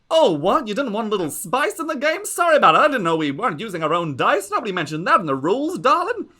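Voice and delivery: high, loud gushing diva; gloating aunt voice. Acted. Lady Ziza taunting the player at the dice table, high gushing diva. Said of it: "she's like".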